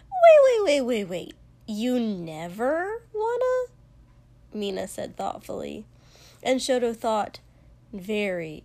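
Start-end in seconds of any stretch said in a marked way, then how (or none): 0.1-3.7 high, loud burst, then drawn-out question; startled disbelief. A narrator's reading. Friend cuts in on boy's admission, startled and incredulous.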